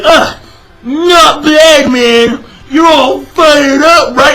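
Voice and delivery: high, drawn-out mocking hero voice; exaggerated, sneering imitation of a pumped-up hero; mocking Natsu. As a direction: drag it out slowly, high and mocking.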